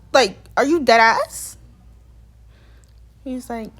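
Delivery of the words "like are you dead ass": incredulous. Retold or imitated. Incredulous reply to the refusal.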